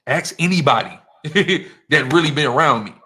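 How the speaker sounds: loud, with a laugh in it; laughing challenge. Real, not acted. Defending himself to his listeners, laughing and loud. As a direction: laugh as you throw out the challenge.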